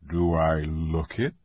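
gently amused lion; lion teasing. A narrator's reading. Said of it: "he said".

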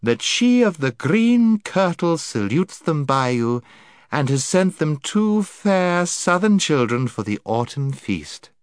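high, sweet, gracious lady; honeyed voice. Acted. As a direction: offer it with sweet charm. Lady's voice.

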